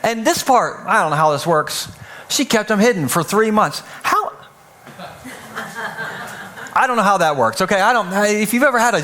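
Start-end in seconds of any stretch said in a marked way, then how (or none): 4.0-4.3 a high, squeaked "How?"; genuinely puzzled, voice jumping up; baffled how. Real, not acted. Asks how baby was hidden.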